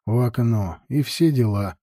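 plain reading voice

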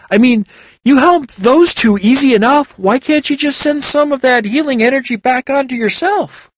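put-on a young man asking, a bit baffled; Sigurd voiced. A narrator's reading.

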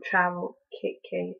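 ordinary delivery

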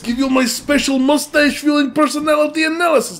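put-on Russian accent. Voicing the mustache reader in a Russian accent. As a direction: boom it like a Russian fortune teller. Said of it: "It's straight up Russian".

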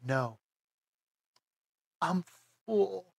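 weak, pitiful little reply; pathetic. Retold or imitated. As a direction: answer feebly like a drugged patient. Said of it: "And pathetically".

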